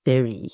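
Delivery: "very" set apart after a pause, low; stress on very. Real, not acted. Vlogger repeating the word to viewers for effect, "very" emphasized. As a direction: drop "very" in on its own as the emphasized word. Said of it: "I do want to emphasize the word".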